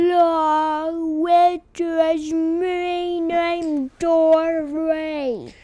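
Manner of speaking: very slow, loud whale talk; Dory. Acted. Dory introducing herself, loud drawn-out whale speech. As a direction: bellow each word long like a whale call. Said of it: "Dory can speak it".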